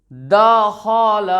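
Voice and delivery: दखल dragged out as daa-khaa-laa; very slow, drawn-out दखल; wrongly stretched vowels. Shown as a demonstration. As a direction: hold every vowel of दखल very long. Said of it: "ऐसे नहीं पढ़ेंगे दोस्तों".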